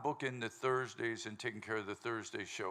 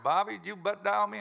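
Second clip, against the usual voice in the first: lightly voiced caller, higher and louder; T G calling back; 8 semitones higher, 6 dB louder than usual. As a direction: raise pitch and volume for a retold phone question. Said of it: "He calls me up".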